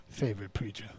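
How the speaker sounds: low echo. Acted. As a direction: echo Favorite preacher low and dry. Mocking repeat of phrase.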